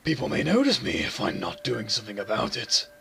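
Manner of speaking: low, lightly layered demon voice; a hint of a menacing demon. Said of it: "I might go with my demon voice again".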